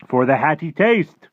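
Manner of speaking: high, loud, drawn-out interjection; excited giant's cry. Acted. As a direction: cry it out slowly and loudly as the giant. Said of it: "cried the B F G".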